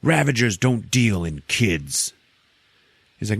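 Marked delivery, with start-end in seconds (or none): 0.0-2.1 raised, louder mock-angry telling-off; acted angry rebuke. Ravager scolding one who broke the code, mock anger. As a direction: play an outraged accuser.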